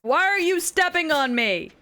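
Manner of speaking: high, loud, drawn-out whine of protest; mock outrage. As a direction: wail it in playful indignation. Mock complaint at being stepped on.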